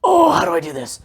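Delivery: high-pitched retold fretting; mock panic. Husband alone with the kitchen trouble, mock panic. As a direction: act out being at a loss.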